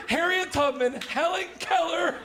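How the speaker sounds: usual speaking voice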